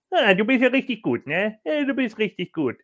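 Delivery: mock Nia Jax. Nia Jax quoted mocking Rhea.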